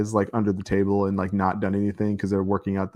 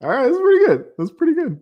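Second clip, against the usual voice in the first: laughing; 7 dB louder than usual. Real, not acted.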